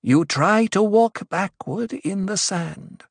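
slow, high oracle's pronouncement; intoned. A narrator's reading. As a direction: intone it with measured authority. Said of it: "Aliyah intoned".

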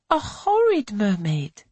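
high, slow gleeful crow. A narrator's reading. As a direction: crow it out, delighted to shock. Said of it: "crows Polly".

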